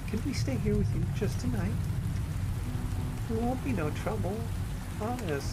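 put-on a small girl afraid of the storm; scared little girl. A narrator's reading. Little girl begs to stay.

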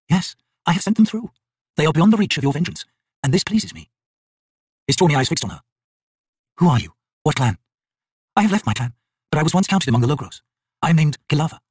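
a hint of a calm defiant Bonecaster; Kilava, proud and defiant. A narrator's reading.

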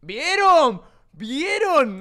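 loud gloating, high and punchy; triumphant. Real, not acted. Streamer gloating to chat after the clip, loud triumphant. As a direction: rub it in, told you so.

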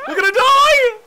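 high, loud panicked yell; panicked shout. Real, not acted. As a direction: scream it in real panic. Panic on the raft.

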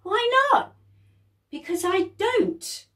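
put-on high, loud childish back-and-forth; bickering sisters. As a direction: whine it like a little kid.

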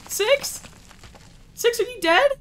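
calling for a fallen friend; worried calling. Real, not acted. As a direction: call out fearing she's hurt. Companion fell, calling in worry.

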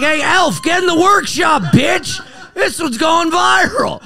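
high-pitched, laughing shout; mock bossy yelling. Host imagines ordering the elf to clip it, mock yelling.

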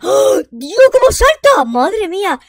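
high, loud, drawn-out astonishment; amazed exclamation. Pretend. Discovering the costume jumps, amazed shout. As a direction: gasp and cry out in amazement.

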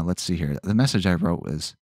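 ordinary talk

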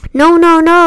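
high, insistent protest; high-voiced alarmed warning; urgent goose. A narrator's reading. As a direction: protest urgently, pitched well up. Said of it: "said the goose".

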